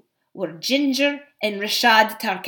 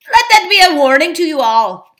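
loud, raised-pitch threat, quickened; loud warning; 8 semitones higher, 9 dB louder, faster than usual. Acted. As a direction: proclaim it as a warning to the crowd. Ape threatens the beasts.

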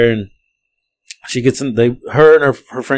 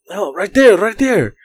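imitated urgent passenger; 8 semitones higher, slower than usual. Acts out passenger urgently pointing.

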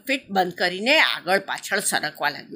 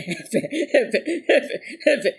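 high burst of laughter; mother bursts laughing; 6 semitones higher than usual. A narrator's reading. Mother croc finally gets the joke and bursts out laughing. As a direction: laugh out suddenly, mouth wide. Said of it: "કરતી હસી પડી".

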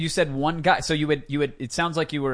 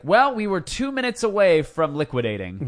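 higher, singsong parody of a tale; mock storyteller drama; 4 semitones higher than usual. Host mimicking founders' last-minute rescue tales, melodramatic. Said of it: "it's always like".

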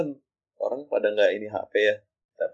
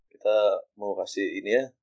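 ordinary talk, nothing marked

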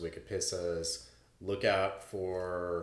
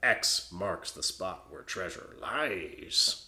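slow, spooky reading of the title; ominous title read; much slower than usual. Acted. Host reads the source title in a spooky, slow voice. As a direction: read it like a treasure-map riddle.

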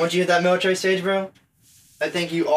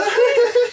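sudden shrieking laugh; loud high laugh; 4 dB louder than usual. Real, not acted. Laughs loudly.

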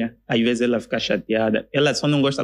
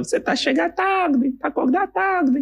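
high, scolding professor voice; a high mock lecturer chiding a late student. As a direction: squeak it out like a nagging teacher. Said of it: "o professor falava".

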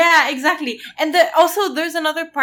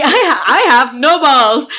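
laughing; 8 dB louder than usual. Acted. Echoing the mock confession.

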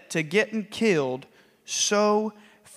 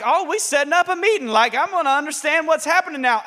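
excited eagerness; 7 semitones higher, 4 dB louder than usual. Retold or imitated. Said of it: "I'm like".

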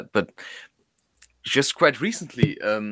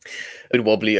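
ordinary talk

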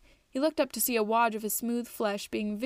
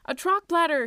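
loud, much higher joyful cry; crying out in triumph; 7 semitones higher, 7 dB louder than usual. A narrator's reading. Twig exulting after scoring, loud and high. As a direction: shout it out in delight. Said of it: "he cried".

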